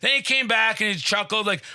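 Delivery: ordinary talk, nothing marked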